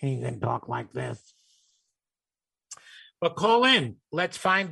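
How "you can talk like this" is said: disguised robotic voice, a bit softer. Shown as a demonstration. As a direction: talk like a voice-changer robot. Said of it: "We'll do one of those robotic sounds".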